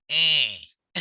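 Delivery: eh said through a plugged nose; eh, fully nasal, no N. Shown as a demonstration. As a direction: pinch your nose and say eh. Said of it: "if you plug your nose and say it".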